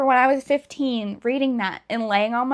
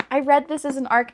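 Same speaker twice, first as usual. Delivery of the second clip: usual speaking voice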